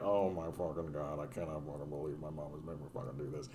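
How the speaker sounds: soft, fast exasperated muttering; eye-rolling mutter. Retold or imitated. Kid griping under their breath about mom's ritual, muttering. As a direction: grumble it under your breath like a fed-up kid. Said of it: "rolling our eyes, just being like".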